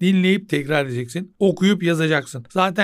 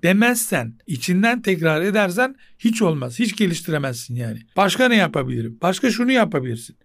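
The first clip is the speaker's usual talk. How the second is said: normal talking voice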